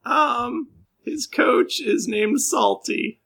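very high, crying, fast rambling; weepy whine. Acted. Jilted girlfriend tells the adventurers about her boyfriend, weeping. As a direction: snivel out what you remember.

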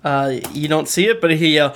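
usual speaking voice

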